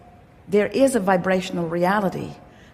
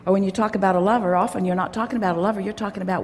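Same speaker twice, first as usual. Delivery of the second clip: normal talking voice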